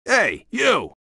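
high, sharp call; guard's challenge. Acted. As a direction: holler to stop an intruder.

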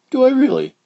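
surprised high voice; squeaky young child. Acted. Child character voice.

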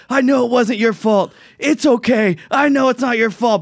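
high, drawn-out imitation of a player yelling; retold yelling. Retelling Bryce yelling at Blake Snell, retold yell. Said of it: "he's yelling at him".

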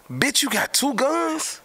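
high, slow mock disbelief; imitating a shocked friend; stunned question. Fat's surprise at two guns.

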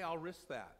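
ordinary talk

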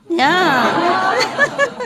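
usual speaking voice

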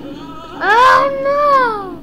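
high, loud drawn-out cry; alarmed wail. Real, not acted. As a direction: wail it as disaster hits. Disaster in the game.

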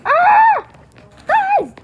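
high sudden yell for help; panicked yell. Real, not acted. Player falling in the game, yelling high for his teammates. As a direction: scream out to your friends.

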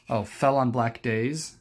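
usual speaking voice

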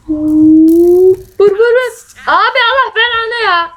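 high, loud frantic yelling. Real, not acted. Gamer yells while losing a fight. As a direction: shout in panic at the game.

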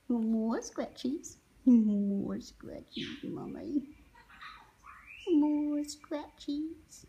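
slow pleading. Pretend. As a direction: stretch each word like begging. Voicing parrot's request.

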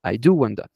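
"do" in "I do want that" hit as the emphasis; stress on DO. Shown as a demonstration. Teacher demonstrates emphatic do to the class. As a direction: lean on the word "do". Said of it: "es un énfasis".

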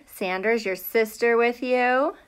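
usual speaking voice

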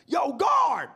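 loud, high acted holler for the guard; calling out. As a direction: shout to get a guard's attention. Calls the jail guard in story.

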